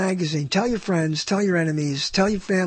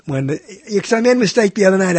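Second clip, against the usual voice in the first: ordinary delivery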